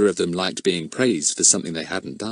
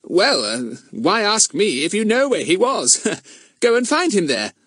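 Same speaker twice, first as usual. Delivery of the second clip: put-on cheeky boy; 12 semitones higher than usual. A narrator's reading. Fatty teases Goon.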